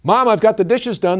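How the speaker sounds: high, loud call to mom; eager girl calling to her mother; calling from the door. A narrator's reading. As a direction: call out proudly from the doorway. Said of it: "the girl called from the door".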